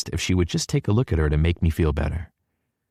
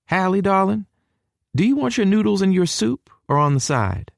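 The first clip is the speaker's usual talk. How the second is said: higher, sweet welcoming mom; Mama's voice. A narrator's reading. Mother greeting her son and his girlfriend, higher and warm. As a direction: greet them like a doting southern mother, pitched up. Mama offering soup.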